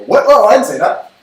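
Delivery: playful fake panic at his own slip; mock alarm. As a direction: yelp it like you've just spilled a secret. Blurted a secret, mock retraction.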